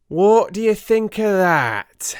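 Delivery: a detective crowing over his discovery; showman's cry. A narrator's reading. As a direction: present it like a showman unveiling his act. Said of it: "cried the detective".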